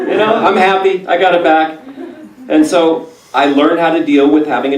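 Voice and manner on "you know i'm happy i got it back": usual speaking voice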